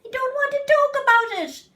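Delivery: nearly shouting the refusal; angry refusal. Acted. Child refuses, near shouting.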